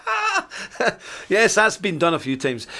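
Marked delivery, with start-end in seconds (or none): 0.1-0.5 mock yelp of pain. Kid face on thistle.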